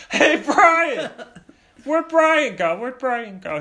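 high mock shout, easing on the repeat; dad calling out. Dad searching for Brian, high hammed-up calling that eases off. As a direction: call around loudly for your missing kid.

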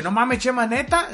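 high-pitched plea. Retold or imitated. Pleading in the taxi fight.

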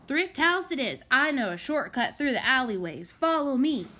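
rally the gang and race ahead; eager leader. A narrator's reading. Freddy leads the others off.

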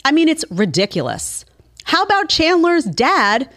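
ordinary talk, nothing marked

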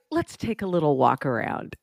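lightly voiced soothing masseuse; a hint of calm spa talk; massagey voice. Retelling the masseuse's opening line, mild soothing voice. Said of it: "she talks in a very, like, massagey voice".

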